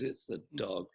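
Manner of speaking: near tears. Retold or imitated. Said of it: "she's almost crying".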